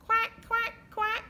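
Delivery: high quacking; duck quack. Acted. Teacher quacking high for the children.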